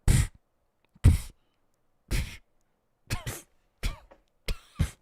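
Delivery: dismissive spitting pfft sounds; mocking pfft. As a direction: blow raspberries at her claim. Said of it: "and Zoe's like".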